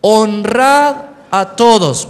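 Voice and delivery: "Honrad" fuerte y muy lento; lectura fuerte. Shown as a demonstration. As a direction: lee fuerte con la congregación. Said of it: "Vamos, fuerte". (manda a la iglesia a leer fuerte, lento)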